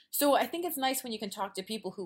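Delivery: ordinary delivery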